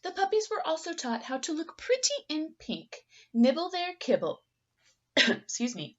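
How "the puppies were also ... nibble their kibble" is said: plain reading voice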